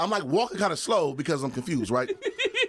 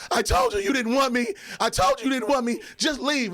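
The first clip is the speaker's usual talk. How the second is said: higher-pitched mock distraught date; imitating tearful upset; 7 semitones higher than usual. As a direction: act out a hurt lover sending someone away. Crying date orders him out.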